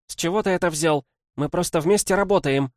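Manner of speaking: high, loud embarrassed blurting; flustered outburst. Acted. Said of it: "выпалила она".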